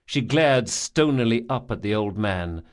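usual speaking voice

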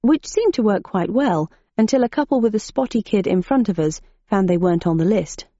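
usual speaking voice

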